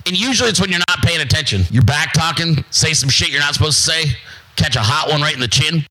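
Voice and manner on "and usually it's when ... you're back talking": ordinary talk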